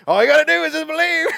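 high, loud mocking preacher voice. Listener mocks the pastor as a preacher, loud and hammed-up. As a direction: ham up a preacher for the crowd. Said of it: "you sound like you're preaching up there".

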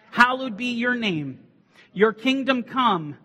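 loud, high unison Lord's Prayer; loud recited prayer. Acted. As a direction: recite it strong and solemn. Congregation prays aloud together.

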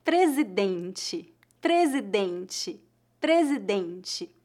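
"presidente" said very slowly and clearly, "dente" ending as "dentchi"; teacher drilling "presidente" three times, carefully; slow model word. Shown as a demonstration. Teacher to students learning Portuguese, slowly sounding out "presidente". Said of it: "Como se pronuncia a palavra president em português?".

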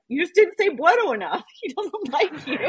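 high, laughing mock scolding; laughing tease. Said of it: "my partner's just like".

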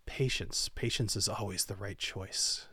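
soft, low calm sword voice; Rhymegeist sword voice. Acted. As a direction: soothe from inside his head. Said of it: "you hear, from your sword Rhymegeist".

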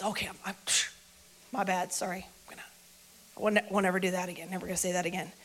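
low, soft self-reproach; mumbled backpedalling under your breath; sheepish apology. Acted. Peter sheepishly regrets his denial.

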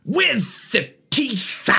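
high, very slow title reveal; mock-dramatic announcement. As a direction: draw out each word like a showy announcer. Announces album pick with drama.

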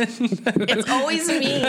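slow laughing whine. Real, not acted. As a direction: laugh through the complaint. Laughing complaint after shot.

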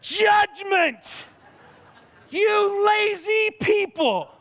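playful outrage, pitched high and drawn out; mock judgmental yell. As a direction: bellow it at the lazy neighbors in jest. Judging unshoveled driveway, yelled.

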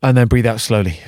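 calm, unhurried breathing guide; guided breathing. Acted. Guiding podcast listeners through a breathing exercise, calm guide voice.

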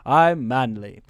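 low, slow, put-on manly robot; deep-toned imitation of a macho robot; Patrick Warburton. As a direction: drop the pitch and declare it with mock manliness. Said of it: "Patrick Warburton's like".

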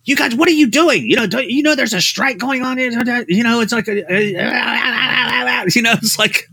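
a high-pitched, agitated impression of an angry old writer; Harlan ranting. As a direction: play the panicked mentor blasting warnings. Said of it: "Harlan's like".

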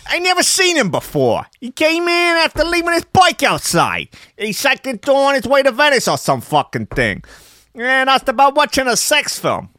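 high, loud, hammed-up Italian shop owner accent; gross Italian accent. Shop owner telling The Sun, in hammed-up Italian accent. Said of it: "I'm gonna do a really gross Italian accent".

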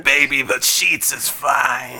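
slow inviting lady voice; man doing a woman's voice. Acted. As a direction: purr it like a lady luring him in. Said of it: "This is a lady's voice".

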